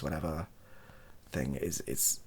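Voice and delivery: usual speaking voice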